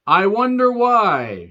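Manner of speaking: slow, drawn-out fake puzzlement; heavily sarcastic musing; sarcastic wondering. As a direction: stretch it out, feigning innocence. Sarcasm about the obvious villain.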